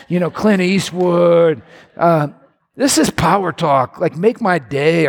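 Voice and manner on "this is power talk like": ordinary talk, nothing marked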